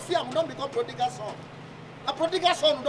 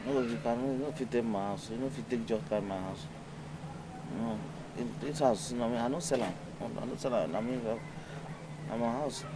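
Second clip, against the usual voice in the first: much lower stubborn owner; low put-on voice; 11 dB quieter than usual. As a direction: refuse in a deep stubborn tone.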